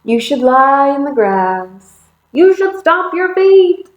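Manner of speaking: high, drawn-out storybook calling; eager suggestions. Acted. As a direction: build from lilting to high and loud. Animal friends eagerly calling out suggestions.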